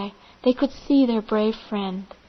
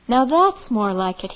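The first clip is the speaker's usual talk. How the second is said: plain reading voice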